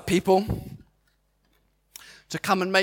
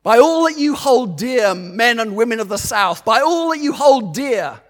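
roaring rallying speech; 4 semitones higher, 4 dB louder than usual. Real, not acted. Preacher urges congregation to stand.

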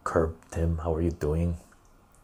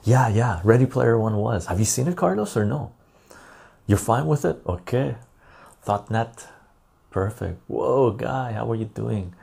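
usual speaking voice

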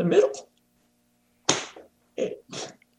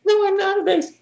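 retold protest in a much higher voice; mock protest. Singer protesting the music director's bass label, high-pitched mock protest. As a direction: object in a high put-on voice.

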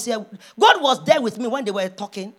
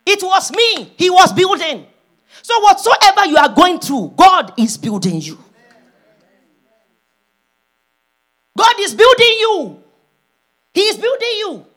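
high, loud, forceful proclamation; emphatic preaching; 7 semitones higher, 7 dB louder than usual. Real, not acted. Preacher assuring the congregation God is building them, loud proclamation. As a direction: drive the point home to the congregation.

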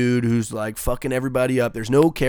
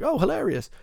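mock praise, pitched far higher; sarcastic; 12 semitones higher than usual. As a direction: roll your eyes at the joke, much higher. Mocking Deadpool's crude humour.

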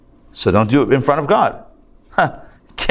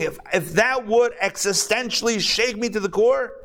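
building emphasis; 8 semitones higher than usual. Real, not acted. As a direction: drive the argument upward with fervor. Preacher's own building emphatic argument.

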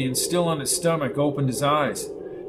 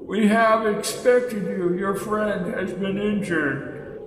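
ancient elder; 6 semitones higher, slower than usual. A narrator's reading. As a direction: intone like an ancient sage. Elder speaks.

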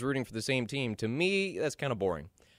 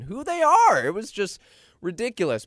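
indignant; 11 semitones higher, 9 dB louder than usual. Real, not acted. Indignant rant about Panthers.

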